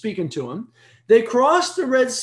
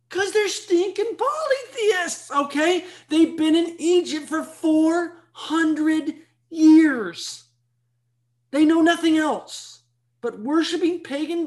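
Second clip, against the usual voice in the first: emphatic high preaching; 8 semitones higher, slower than usual. Real, not acted. Exclaiming about polytheists.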